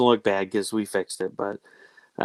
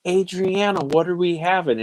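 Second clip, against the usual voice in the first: mock yell, raised pitch; 8 semitones higher than usual. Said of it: "Roderick yells in".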